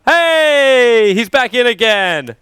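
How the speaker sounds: loud, high welcome-back cheer; excited cheer. Real, not acted. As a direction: call out a drawn-out, delighted greeting. Cheers player reconnecting to game.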